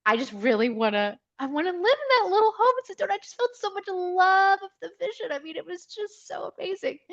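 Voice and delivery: high, swelling voice full of love; overcome with emotion. Real, not acted. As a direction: gush about a cherished dream, voice climbing high. Moved retelling her heartfelt vision.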